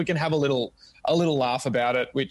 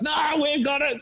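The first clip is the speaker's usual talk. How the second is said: bossy premiers; 11 semitones higher, slower than usual. Retold or imitated. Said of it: "state premiers are like".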